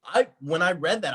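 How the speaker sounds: ordinary talk, nothing marked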